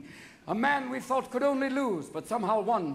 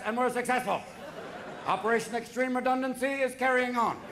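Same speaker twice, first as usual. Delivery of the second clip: military briefer. Acted. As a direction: parody official bombing report.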